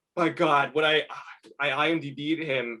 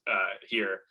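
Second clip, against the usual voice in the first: usual speaking voice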